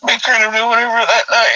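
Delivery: ordinary talk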